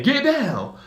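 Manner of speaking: high, sharp "get down"; coworker's warning. Retold or imitated. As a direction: warn the whole room. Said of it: "one of my coworkers saying".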